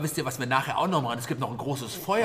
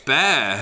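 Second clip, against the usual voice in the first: disgusted yuck; 9 dB louder than usual. Pretend. Tastes the sponge.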